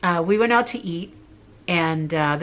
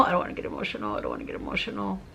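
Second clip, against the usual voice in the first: soft near-whisper, holding back tears; fighting tears; 9 dB quieter, much faster than usual. Real, not acted. Testimony giver telling herself not to get emotional, hushed and choked up. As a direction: whisper it to yourself to keep from crying.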